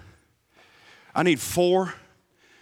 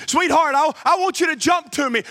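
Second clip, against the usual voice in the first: higher, louder call to his daughter; father urging his scared daughter from below, louder; 9 semitones higher, 4 dB louder than usual. Acted. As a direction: call up into the dark, pressing her. Father urges again, louder.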